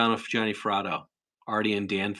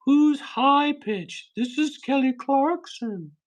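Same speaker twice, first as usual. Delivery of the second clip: much higher, slow, squeaky falsetto; 3 dB louder, much slower than usual. Pretend. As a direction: squeak it out in a silly high voice. Reading chat, squeaky voice bit.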